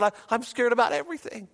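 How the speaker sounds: high, fast, fretful stammer; retold panic. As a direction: imitate a frightened caller. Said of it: "she was just panicked".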